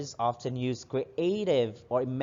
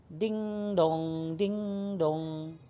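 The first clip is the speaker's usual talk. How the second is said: higher sing-song ding dong; doorbell chime; 5 semitones higher, 4 dB quieter, much slower than usual. Shown as a demonstration. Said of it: "A doorbell says".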